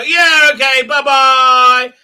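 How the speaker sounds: loud, high sing-out agreement; hammed-up dismissive goodbye; mock cheery brush-off. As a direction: send him off with a breezy loud farewell. Voices families brushing him off.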